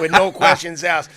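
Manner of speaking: words burst out through a big laugh; laughing loud. Real, not acted. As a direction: laugh hard while you finish the line.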